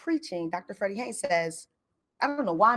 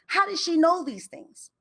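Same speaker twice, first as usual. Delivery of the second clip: amazed; 8 semitones higher than usual. Real, not acted. Amazed wondering.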